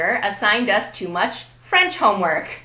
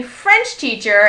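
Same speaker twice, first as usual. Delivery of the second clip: FRENCH teacher, first word punched; stress on French; 7 dB louder, slower than usual. Shown as a demonstration. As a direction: hit French, let teacher fall away. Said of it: "Notice the stress on the first word".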